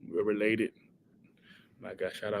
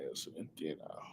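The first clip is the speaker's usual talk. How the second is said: teasing whisper; 8 semitones lower, 19 dB quieter, faster than usual. Real, not acted. Teasing hold-back of his answer.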